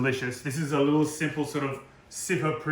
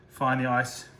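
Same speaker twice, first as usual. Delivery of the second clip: ordinary talk, nothing marked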